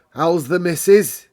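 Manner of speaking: loud broad Yorkshire query. Acted. Station master asks Medlock, broad Yorkshire. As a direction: ask after the missus in Yorkshire.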